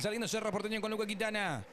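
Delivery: ordinary talk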